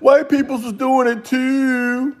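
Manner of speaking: high, slow whiny mocking voice; mock whining protester. Mocking the protesters with a high whiny cry. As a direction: whine it like a crybaby complaining. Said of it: "y'all gonna cry it's racist".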